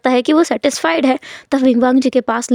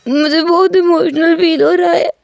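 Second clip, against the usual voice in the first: higher, slow, tearful voice; on the verge of tears; 4 semitones higher, slower than usual. Acted. Wing tells Wangji he feels emotional, high and tearful. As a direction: let the voice crack as tears come. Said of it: "मैं रोने लगूंगा".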